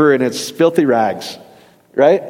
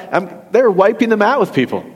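ordinary talk

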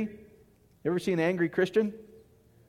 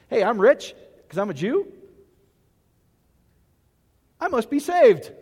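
smug mock boast, louder at saved; 3 semitones higher, 3 dB louder than usual. Pastor mocks a rich man sure he's saved, smug. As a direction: parody a proud rich man.

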